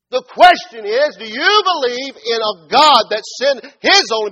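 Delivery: loud, high, impassioned preaching; full-force preaching. Real, not acted. Preacher pressing the congregation on belief, at full force. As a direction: drive each phrase home to the congregation.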